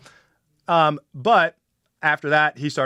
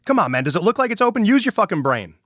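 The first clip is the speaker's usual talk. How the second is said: fast, higher and louder sneering scold; mocking rude attendant; 8 semitones higher, 5 dB louder than usual. Pump attendant snapping at the driver, higher, louder and fast. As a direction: mimic the rude attendant's contempt. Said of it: "He goes".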